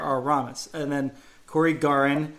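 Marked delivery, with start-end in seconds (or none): 0.0-0.7 "Aramis" said ah-RAH-mis; "Aramis" with a strong second syllable; stress on RA. Shown as a demonstration. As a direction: land on the middle syllable of "Aramis". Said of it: "putting the stress on the Ra".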